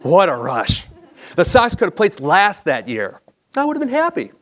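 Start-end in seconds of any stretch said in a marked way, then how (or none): none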